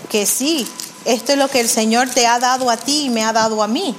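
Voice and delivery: ordinary talk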